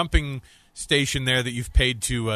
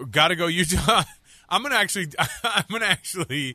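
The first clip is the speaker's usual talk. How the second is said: unable to get through it for laughing; real giggles breaking into the words; 3 semitones higher than usual. Real, not acted. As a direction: try to say it, cracking up. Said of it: "Why can't you say it without laughing?".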